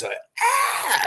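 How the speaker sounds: sudden high shriek, imitating a panicking tough guy; girly scream. Big tough guy spots a spider on his chest, mock girly scream. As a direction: shriek it way up high like a scared kid. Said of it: "screaming like a fucking girl".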